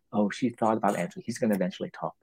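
soft knowing aside; audience's private reasoning. Retold or imitated. Audience member working out the play's trick, hushed. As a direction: murmur it as a realisation.

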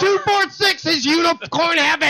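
high, gushing jackpot announcement; mock celebration. Phone system celebrates the caller's button mash, mock excited.

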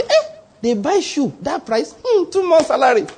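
mock-impressed bragging about a price; boasting husband. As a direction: brag like a show-off husband, lightly voiced. Mimics a man boasting of shoes.

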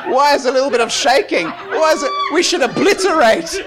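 high, insistent preaching that builds; fervent astonishment. Real, not acted. Preacher exclaims at mere shaking.

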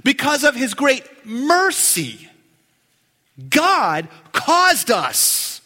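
"mercy" and "caused us" stretched out slowly; deliberate emphasis. Real, not acted. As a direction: hammer home "God caused us", word by word. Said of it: "I'm emphasizing that on purpose".

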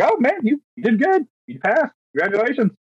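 lightly voiced examiner announcing the pass; cheery examiner. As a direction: play the examiner breaking good news. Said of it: "But he's like".

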